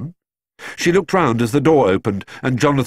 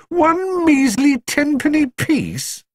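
put-on very high, drawn-out boyish disgust; slower than usual. A narrator's reading. Jonathan complaining about the meagre reward, disgusted. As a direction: stretch out the scorn in a high voice. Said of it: "exclaimed Jonathan disgustedly".

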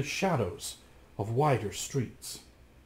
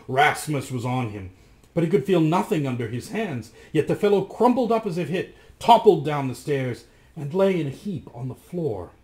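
ordinary delivery, nothing marked

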